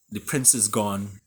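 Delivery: ordinary delivery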